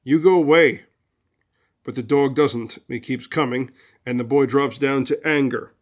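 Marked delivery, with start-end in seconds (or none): 0.0-0.8 put-on louder, higher kid yelling a dog away; mock yell of an antagonised boy; acted boy's yell. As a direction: shoo off a threatening dog. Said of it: "he yells at the dog".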